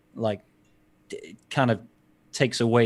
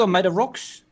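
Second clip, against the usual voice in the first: higher Korg voice, high and put on; Korg impression; 8 semitones higher than usual. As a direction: play Korg, the cheerful rock man. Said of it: "Sound like Korg now".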